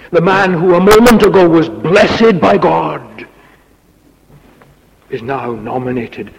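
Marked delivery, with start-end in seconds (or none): 0.1-3.0 louder and higher proclamation; full-force preaching. Acted. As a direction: proclaim it in amazement. Preacher's emphatic exclamation.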